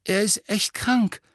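put-on high, anxious boy; worried, pitched well above the narration; frightened voice. A narrator's reading. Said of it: "sagte eine ängstliche Stimme".

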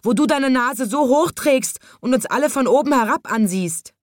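high-pitched squawking tirade; screeching parrot. A narrator's reading. Parrot accusing the haughty giraffe, screeching high. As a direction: rail at them like a parrot. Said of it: "kreischte der Papagei".

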